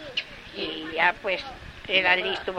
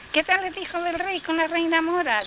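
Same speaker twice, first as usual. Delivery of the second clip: higher, lilting question; lightly voiced dove; 10 semitones higher than usual. A narrator's reading. Dove asking the gardener about the prince, high and lilting. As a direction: ask sweetly and sadly.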